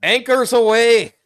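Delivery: high, loud, slow Irish shout; Irish-accented yell. Acted. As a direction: belt it with an Irish lilt. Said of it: "I'm just gonna go Irish on this".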